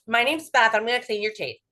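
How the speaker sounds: businesslike and brusque, no softness; brisk, impersonal greeting. Shown as a demonstration. Clinician showing how not to greet a patient, brisk and impersonal. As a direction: announce yourself briskly, as routine. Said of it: "You know, not like".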